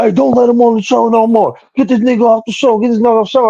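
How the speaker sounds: high mock voice of another caller; mock other guy. Retelling a caller who wanted him off the show, high mocking voice. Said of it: "but he was like".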